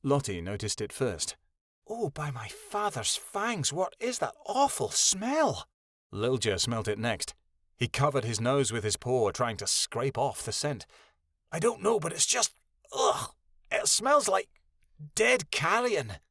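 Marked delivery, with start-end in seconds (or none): 1.9-5.7 high, slow, drawn-out disgust; high-pitched revolted exclamation. A narrator's reading. Pup recoiling from awful smell. 11.5-16.2 disgusted. A narrator's reading. Character revolted by the stench.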